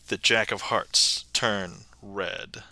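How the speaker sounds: low, soft, slow incantation; magician's reveal. Pretend. Magician willing a card to change, low slow suspense. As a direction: draw it out slowly, low and hushed.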